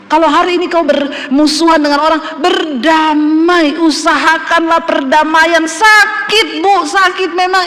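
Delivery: impassioned pastor urging the church to forgive; forceful preaching. Acted. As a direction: drive the call to obey home. Pastor exhorts congregation forcefully.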